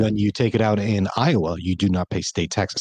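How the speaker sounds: normal talking voice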